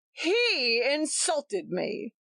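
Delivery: mild mock shout. A narrator's reading. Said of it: "shouted Tompkins".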